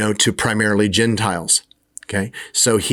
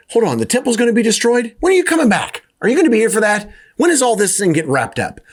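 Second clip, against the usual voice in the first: much higher, frantic mock panic; alarmed disciples; 10 semitones higher, faster than usual. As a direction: squeal out the questions in a rush of alarm. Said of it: "they're like".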